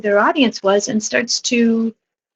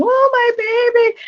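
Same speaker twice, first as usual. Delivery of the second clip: imitated wailing; 3 dB louder than usual. Grandmother wailing over the bride, imitated in a high voice. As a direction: wail it like an overcome grandmother. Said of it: "And she's wailing".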